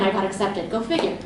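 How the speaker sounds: ordinary talk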